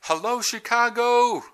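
put-on high, loud rally cry in a British accent. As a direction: greet the crowd like a Brit on stage. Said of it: "a British accent".

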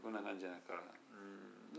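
ordinary talk, nothing marked

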